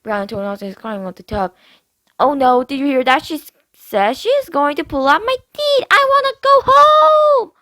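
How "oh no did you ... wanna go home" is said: put-on much higher and louder, scared child whimper; little sister whining in fear; whining little girl. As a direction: whine for Daddy like a frightened kid. Brianna voiced.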